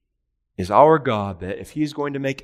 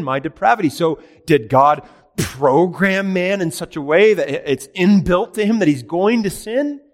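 higher and louder, building with each question; pressing rising questions; 7 semitones higher, 6 dB louder than usual. Acted. Preacher pressing his congregation on who is to blame, building louder. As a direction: push the congregation toward the answer.

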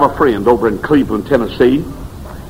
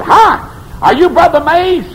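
put-on high, slow child's greeting; a small girl greeting the visiting preacher at the church; lightly voiced little girl; slower than usual.

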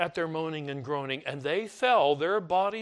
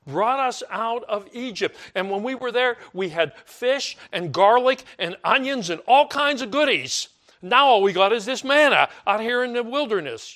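higher, louder nostalgic whine; mock griping; 7 semitones higher, 8 dB louder than usual. Israelites grumbling to Moses over Egypt's food, mock whine. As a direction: sulk over the lost onions and garlic. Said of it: "they complain to, to Moses".

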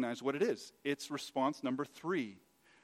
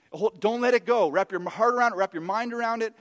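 loud, high, insistent exhortation; impassioned preaching; 7 semitones higher, 9 dB louder than usual. Acted. Preacher urging listeners to hold fast to truth, insistent. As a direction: hammer each plea home at full voice.

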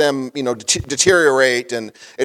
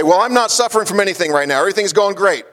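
higher, fast, breezy imitation of a carefree churchgoer; mock congregant; 7 semitones higher, faster than usual. Preacher mimicking a congregant who says life is great, higher and breezy. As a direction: play the member who claims nothing is wrong, higher and quick. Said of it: "You say".

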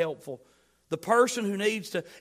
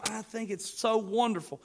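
ordinary talk

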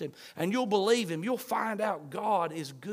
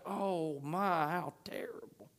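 hushed dismay; 8 dB quieter, slower than usual. Acted. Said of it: "literally it's almost like".

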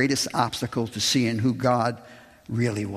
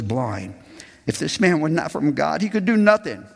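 higher, amazed and insistent; marveling defense; 5 semitones higher than usual. Acted. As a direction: marvel at their blindness aloud. Reads the healed man's defense.

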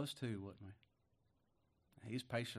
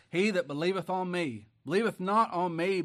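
preaching scripture; 5 semitones higher, 7 dB louder than usual. Acted. Quoting Jesus, preaching.

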